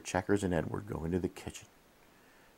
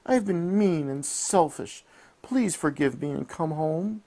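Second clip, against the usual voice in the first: pleading cry; 10 semitones higher, 4 dB louder than usual. A narrator's reading. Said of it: "she cried".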